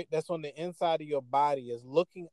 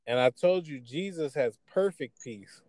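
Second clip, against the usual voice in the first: usual speaking voice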